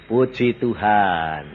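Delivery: normal talking voice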